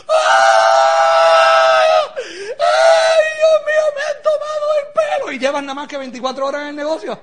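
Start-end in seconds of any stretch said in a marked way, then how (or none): 0.1-5.3 long high scream into a loud wail; hammed-up panic. Newcomer feeling conned after one day, hammed-up panic.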